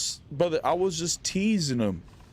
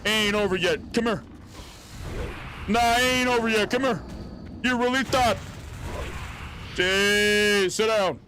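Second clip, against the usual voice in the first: hyped taunting; 6 semitones higher than usual. Real, not acted. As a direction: taunt him as you win the duel. Taunting enemy during fight.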